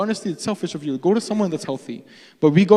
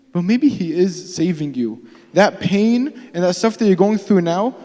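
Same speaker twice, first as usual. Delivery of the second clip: ordinary talk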